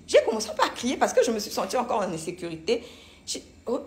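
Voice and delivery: ordinary delivery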